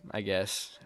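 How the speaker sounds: usual speaking voice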